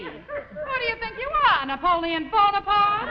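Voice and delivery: loud, high challenge; indignant retort. Acted. Wife challenges bossy husband, indignant retort. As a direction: throw it back at him, climbing on the name.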